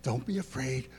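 put-on gentle dad calming his kid; reassuring dad. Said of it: "It's like a dad with a kid".

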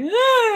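high, hammed-up wail; imitated hysterical cry; mock crying wail. Contestant alone in her car, mock crying wail. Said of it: "I'm just crying and I'm hysterical".

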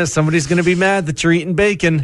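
guilty inner voice. Retold or imitated. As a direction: rattle it off like a scolding voice in your head. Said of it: "There's that voice".

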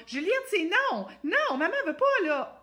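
high, loud, pleading little voice; small high voice. Shown as a demonstration. Mother pleading with Juliette, high and shrill-light. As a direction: squeak the no like a pushover. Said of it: "J'ai pas une petite voix claire".

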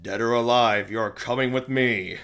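stern robotic cop line; RoboCop catchphrase. Retold or imitated. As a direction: deliver it like a cold robot cop. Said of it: "especially his catchphrase".

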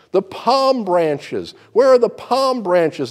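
loud, high, indignant cry; shouting. Acted. Donkey shouting at the crowd in the market, loud. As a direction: yell for the missing palm branches. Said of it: "he shouted".

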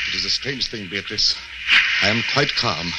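low, soft and still; quiet, strangely composed; eerie calm. Acted. Captain telling Beatrice he feels calm, eerie quiet.

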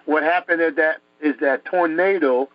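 ordinary delivery

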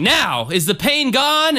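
high-pitched preacher question; Jim Jones. Acted. Healer asks the woman about her pain, dramatic preacher voice. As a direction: play the theatrical healer. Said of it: "and he goes".

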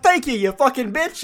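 hammed-up sarcastic thanks; rough Tony Soprano impression. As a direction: bicker like a TV mobster. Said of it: "The impression is coming out".

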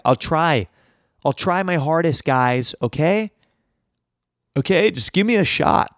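ordinary talk, nothing marked

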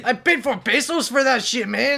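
high, loud, hammed-up Mexican accent. Shown as a demonstration. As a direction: demand it in a cartoon Mexican accent. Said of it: "Do a Mexican accent".

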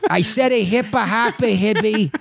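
hammed-up wicked Boston rap line; bad Boston accent, rapping; awful Boston accent. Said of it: "It's awful accents".

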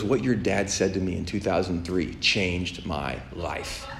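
low, hushed, slow and solemn; imitating a man earnestly sharing something momentous; real serious. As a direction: confide it gravely, slowing right down. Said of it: "He got real serious".

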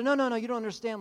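loud, high no, no, no in protest; insistent protest. Acted.

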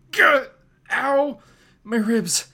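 loud, high cry of pain. Acted. Peter thrown into a building, crying out in pain. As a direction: yelp as you hit the wall.

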